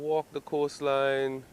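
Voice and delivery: normal talking voice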